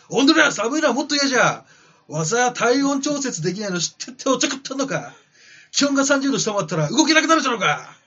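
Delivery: loud, rough yakuza-style growl; gruff gangster boss voice. Acted. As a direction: bark it like a tough old mob boss. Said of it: "ティタノボアさんの言葉".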